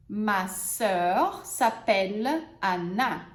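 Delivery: teacher repeats the example; slow model sentence. Shown as a demonstration. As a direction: repeat it slowly and calmly. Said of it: "vamos usar os exemplos agora".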